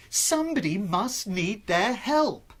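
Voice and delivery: plain reading voice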